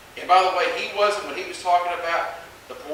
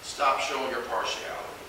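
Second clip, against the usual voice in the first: quiet firm warning; 5 semitones lower, 9 dB quieter, slower than usual. Acted. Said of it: "James is saying".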